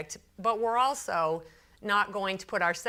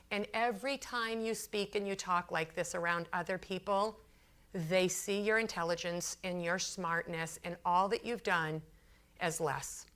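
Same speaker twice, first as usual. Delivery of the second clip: softer, lowered, sincere voice; level with him gently, as retold; earnest appeal; 3 semitones lower, 5 dB quieter than usual.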